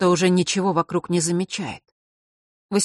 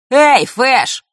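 loud, higher-pitched hail; shouted call; 8 semitones higher, 9 dB louder than usual. A narrator's reading. Mark calls to Fesh to bait him, shouting out. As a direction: call out sharply across the room. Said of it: "выкрикнул Марк".

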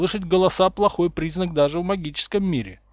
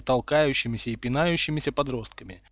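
plain reading voice

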